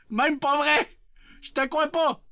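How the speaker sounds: high, punchy protest; exclaimed disbelief. Acted. Streamer tells chat he doesn't believe it, high exclamation. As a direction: blurt out your disbelief in a high voice.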